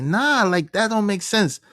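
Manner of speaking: high-pitched objection; incredulous protest. Retold or imitated. Husband objecting to wife coming along, high incredulous protest.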